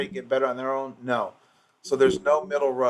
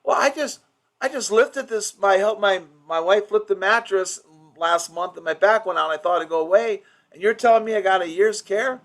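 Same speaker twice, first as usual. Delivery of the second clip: higher-pitched protesting patient voice; incredulous patient; 6 semitones higher, 3 dB louder than usual. Acted. Patient objecting to the doctor about a year's care, incredulous. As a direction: act out a patient pleading their case in disbelief.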